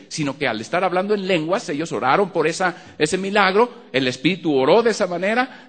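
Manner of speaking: high, loud preaching at full force; rising pulpit fervor; fervent preaching. Acted. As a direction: preach it out to the congregation.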